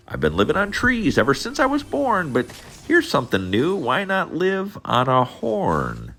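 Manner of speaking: very high chirpy little bird, excited; chirping bird. Acted. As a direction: chirp it out like a bird finding a new home. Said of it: "he chirped".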